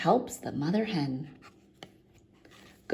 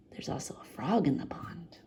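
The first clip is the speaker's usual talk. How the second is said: whisper; 5 semitones lower, 6 dB quieter than usual. A narrator's reading. Whispered aside.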